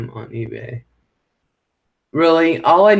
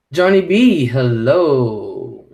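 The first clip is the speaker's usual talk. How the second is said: normal talking voice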